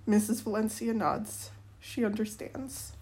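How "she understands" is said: ordinary delivery, nothing marked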